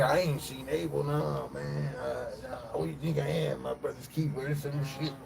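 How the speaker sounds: high, softened voice of denial; Cain. Acted. Cain answering God about his missing brother, denying. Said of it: "Cain said".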